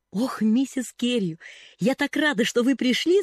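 high, loud gush of relief; gushing girl greeting the lady; chattering. A narrator's reading. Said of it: "затараторила девочка".

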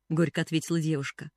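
usual speaking voice

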